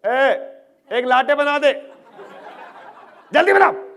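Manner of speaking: very loud, high shouted command in Hindi; rude barked order. Acted. Mimicking how baristas don't talk to each other, rude shout. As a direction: bark it like an impatient boss. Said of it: "They don't talk like this".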